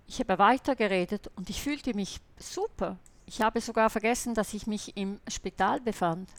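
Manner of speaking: usual speaking voice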